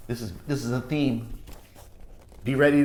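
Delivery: usual speaking voice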